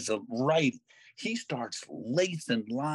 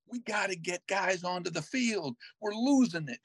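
urgent; 6 semitones higher than usual. Retold or imitated. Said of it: "And he's like".

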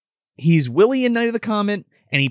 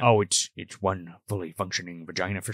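mock deep voice; 11 semitones lower, 8 dB quieter than usual. Jokey low voice banter.

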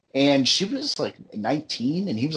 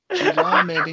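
squeaky high voice; 3 dB louder, slower than usual. Pretend. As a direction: jump way up in pitch. Repeats the suggestion, much higher.

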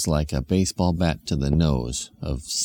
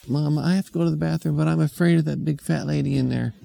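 put-on child voice; 8 semitones higher, faster than usual. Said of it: "one of the kids said".

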